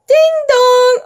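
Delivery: loud, high sing-out doorbell; doorbell imitation. A narrator's reading. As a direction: ring out like a doorbell.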